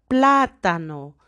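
"plátano" with a loud, long PLÁ; teacher's emphatic "plátano", "plá" strongest; stress on PLÁ. Shown as a demonstration. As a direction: punch the first syllable of "plátano". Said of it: "que es la sílaba más fuerte".

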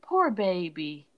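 slow, mock-pitying "Poor baby"; condescending sympathy, drawn out; patronizing pity. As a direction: coo it with fake sympathy. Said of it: "You're just like".

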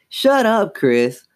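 high snap, quickly dropping; snapped. A narrator's reading. Chris to the dummy, a high snap. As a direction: snap it with a jump in pitch. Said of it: "Chris snapped to the dummy".

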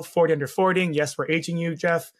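ordinary talk

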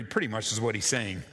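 ordinary delivery

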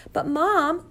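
high-pitched, reluctant objection; child's protest. A narrator's reading. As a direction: whine back at your mother. Child protests order to jump.

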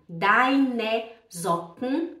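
very slow "Deine Socken", with Deine as "DAI-ne"; final E sounded. Shown as a demonstration. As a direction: draw out Deine in two syllables, then Socken as "zok-n". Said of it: "tem que ter deine".

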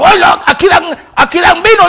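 put-on a drunk in the joke shouting that there's free wine; excited drunk. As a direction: perk up like a drunk who smells wine.

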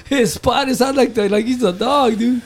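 high, laughing tease; mock him through the laugh; buddy's mocking voice. Buddy teasing about the dog's spot, high laughing tease.

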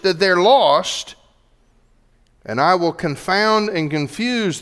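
slow, high, emphatic pulpit proclamation; forceful preaching. Acted. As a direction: declare it loudly and deliberately to the congregation. Preaches in Jesus's words.

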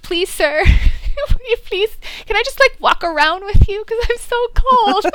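2 high, whiny begging voice; 3 beg him sweetly to keep you warm; 1 mock pleading. 4 fan begs the actor to walk around with her, mock pleading.

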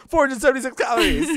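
high, loud, fast chirpy announcement; gleeful Coco. Retold or imitated. Coco showing off her calorie power to the girls, high and gleeful. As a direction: proudly announce it like a party trick. Voicing Coco's new power.

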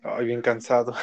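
normal talking voice

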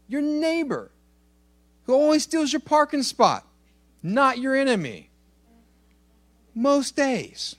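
playful high aside about the neighbor; teasing joke. Pretend. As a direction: tease them with a wink in the voice. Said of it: "I'm kidding".

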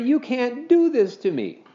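put-on high, pleading boy's wail. A narrator's reading. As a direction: plead with Mom, wailing. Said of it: "wailed Henry".

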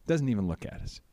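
normal talking voice

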